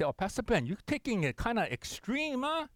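hint of a needling congregant; mocking questioner. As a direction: lightly voice a skeptical churchgoer. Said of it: "they may say".

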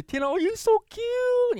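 put-on very high, sing-song baby talk; cooing pet talk. Talking sweetly to the dog, very high coo. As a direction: coo at her like a beloved pet. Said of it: "It's the tone of your voice".

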